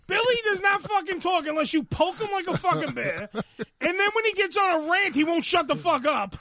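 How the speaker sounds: ordinary talk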